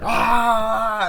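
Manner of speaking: long, high, loud mock scream; imitated scream. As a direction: act out a drawn-out battle yell.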